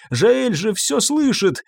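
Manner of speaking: plain reading voice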